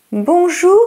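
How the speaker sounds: ordinary delivery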